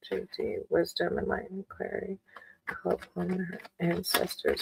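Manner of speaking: hushed, murmured invocation to spirit; whispered prayer. Real, not acted. Tarot reader asks Divine for guidance on JD's dream, whispered. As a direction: pray softly under the breath, asking for guidance. Calls on higher power.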